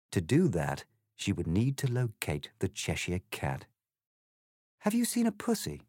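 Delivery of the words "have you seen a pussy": put-on a lightly raised, polite girl's voice; young Alice, lightly voiced. A narrator's reading. Alice politely asks the egg a question, lightly voiced girl. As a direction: ask it as a prim, proper girl. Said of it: "she asked".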